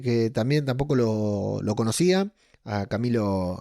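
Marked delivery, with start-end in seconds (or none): none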